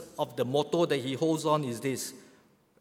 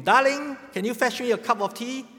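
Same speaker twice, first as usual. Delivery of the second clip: high, raised call to someone in another room; 9 semitones higher, 4 dB louder than usual. Acted. Old man to wife from the living room, calling out. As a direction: pitch it up and louder to reach the kitchen. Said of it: "he called out to her".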